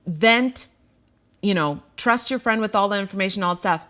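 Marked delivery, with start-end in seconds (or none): none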